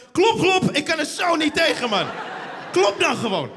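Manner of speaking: hammed-up irritation; mock irritated grumble. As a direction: fuss about the knock. Complaining about door knock.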